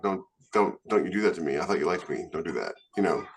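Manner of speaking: normal talking voice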